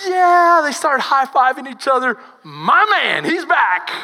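loud, high whoop of joy; cheering excitement. Retold or imitated. Disciples high-fiving about Jesus, loud cheering. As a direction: celebrate like a team that just won.

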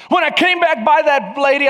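ordinary talk

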